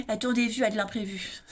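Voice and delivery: softly laughing through the words; amused, chuckling read. Real, not acted. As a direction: let a laugh slip in as you say it. Repeating herself, breaks into laughter.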